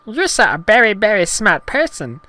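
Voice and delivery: high, loud cartoon alien voice; Jar Jar Binks. Gungan reassuring embarrassed heroine, high loud goofy voice. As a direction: praise her in goofy falsetto. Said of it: "when Jar Jar said".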